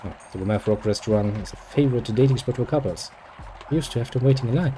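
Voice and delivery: usual speaking voice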